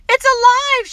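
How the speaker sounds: high, loud scream. A narrator's reading. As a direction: scream it out in horror. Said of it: "she screamed".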